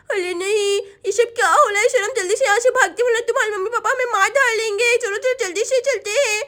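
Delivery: put-on lisping child urging escape; worried little Chinki. Girl urges friend to flee the ghosts, worried child voice.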